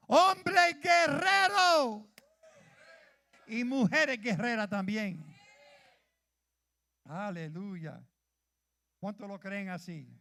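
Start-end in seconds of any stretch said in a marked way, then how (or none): 0.1-5.2 loud, rallying preacher's cry; fired-up call to arms; rousing call. Real, not acted. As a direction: lift the crowd with a battle call. Rallying the church to fight.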